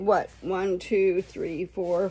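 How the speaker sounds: usual speaking voice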